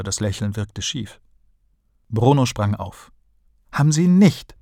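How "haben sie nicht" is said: put-on high, loud disbelieving boy; shocked child cry, higher and louder; surprised. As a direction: jump up and blurt it out in disbelief. Bruno jumps up in disbelief.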